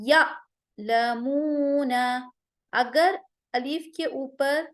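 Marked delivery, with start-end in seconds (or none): 0.1-1.9 یا لمون with a sharp glottal catch on the alif; Qur'anic hamza with a hard stop; hamza with a jolt. Shown as a demonstration. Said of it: "ہم الف کے اوپر آواز کو سختی سے جھٹکا دیں گے".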